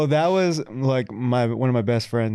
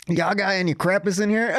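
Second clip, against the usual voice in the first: slow, higher-pitched Southern drawl; Southern US accent; 6 semitones higher, slower than usual. Retold or imitated. Southern family ordering in Paris, thick Southern accent. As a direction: drawl it like a Texan tourist, a laugh in it. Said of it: "another Southern family that walked in".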